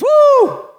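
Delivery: loud, high, drawn-out "woo"; high celebratory holler; delighted whoop. Acted. Acting out God's delight.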